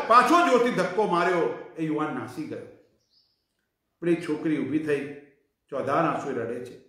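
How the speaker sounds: ordinary delivery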